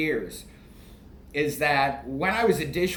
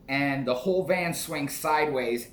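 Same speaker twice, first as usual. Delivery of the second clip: normal talking voice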